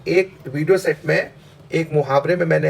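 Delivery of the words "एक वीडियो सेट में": ordinary talk, nothing marked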